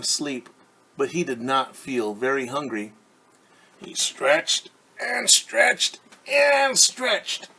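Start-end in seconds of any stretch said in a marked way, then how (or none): 3.8-7.5 slow, drawn-out and high, rising with effort; stretched out slowly. A narrator's reading. Reader drawing out the stretch for children, slow and high. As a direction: stretch each word long like a waking caterpillar.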